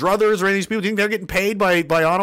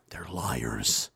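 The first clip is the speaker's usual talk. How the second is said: a hushed whisper, much lower and softer; whispered accusation; 11 dB quieter than usual. Real, not acted. Telling the viewers the politicians are liars, whispered. Sudden aside about politicians.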